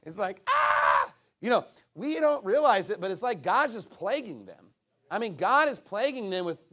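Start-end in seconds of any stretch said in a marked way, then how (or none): 0.1-1.1 mock anguished yell. Mock cry of the plagued.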